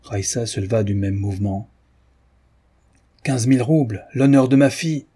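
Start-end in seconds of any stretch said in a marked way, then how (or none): 3.2-5.1 louder, higher indignant exclamation; outraged cry. A narrator's reading. Father outraged at the matchmaker's money, crying out. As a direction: cry it out, offended for his daughter. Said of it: "s'écria-t-il".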